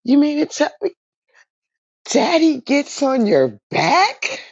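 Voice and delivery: high, slow astonished question; incredulous child. Retold or imitated. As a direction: draw out a child's disbelief. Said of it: "She said".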